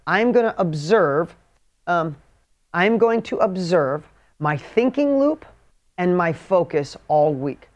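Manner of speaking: slow, word by word dictation. Shown as a demonstration. Dictating the weekly task to the audience, slowly. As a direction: spell it out slowly so they can write. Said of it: "seriously, write this down".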